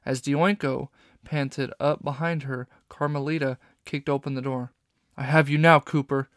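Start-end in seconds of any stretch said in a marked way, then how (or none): 5.2-6.3 loud, bursting into the room; triumphant shout. A narrator's reading. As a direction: shout it as you kick the door in. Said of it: "Inspector Fox shouted".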